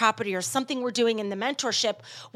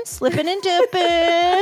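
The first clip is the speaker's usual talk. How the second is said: higher, stretched-out catchphrase; playful drawn-out repeat; 7 semitones higher, 3 dB louder, much slower than usual. Pretend. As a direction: draw out DIPPING long and high.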